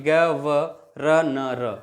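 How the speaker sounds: ग व र न र slowly separated, "ga, va, ra, na, ra"; letter by letter. Shown as a demonstration. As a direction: sound out ग व र न र one letter at a time. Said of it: "हम यहाँ पे उच्चारण कर रहे हैं". (ग व र न र, अक्षर अलग-अलग करके)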